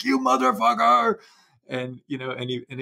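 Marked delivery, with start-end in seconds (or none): 0.0-1.2 retold furious yell. Playwright Lanford cursing at his finished scene, high mock yell. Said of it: "and I'm like".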